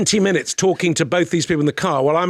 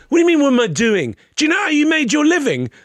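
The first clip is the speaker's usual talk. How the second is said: high, quick mock indignation; indignant retort; 9 semitones higher, faster than usual. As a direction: imitate an affronted man arguing back, pitched up. Drunk intruder argues back in retelling.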